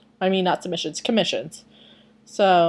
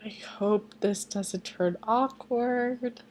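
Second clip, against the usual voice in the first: slow nervous whisper; 3 semitones higher, 6 dB quieter, much slower than usual. Real, not acted. Vlogger whispering her dread before the chapter. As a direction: breathe out the worry slowly.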